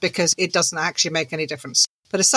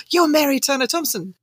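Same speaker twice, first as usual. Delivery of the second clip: starstruck fan; 7 semitones higher than usual. Retold or imitated. Fan recognising author in supermarket.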